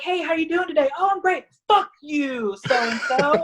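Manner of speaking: trainer's fake-friendly greeting turning into a playful curse; imitating the trainer. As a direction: ham up a sudden jokey insult. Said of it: "he'd be like".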